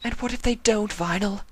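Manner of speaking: fearful challenge; doubtful Octavia. Acted. Octavia asking Vinyl what if her parents don't love her, anxious.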